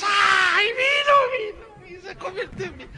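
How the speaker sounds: very high shrieking wail of delight; ecstatic crowd cry. Retold or imitated. Mocked congregation shrieking at the wine trick, very high. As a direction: scream like you just saw a miracle.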